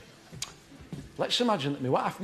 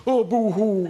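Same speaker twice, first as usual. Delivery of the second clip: silly boo-hoo crying; 3 semitones higher, 5 dB louder, slower than usual. Shown as a demonstration. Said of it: "That's silly".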